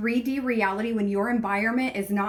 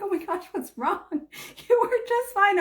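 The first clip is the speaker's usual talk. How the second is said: high, startled worried husband; concerned surprise, up high; 10 semitones higher than usual. Retold or imitated. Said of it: "And he's like".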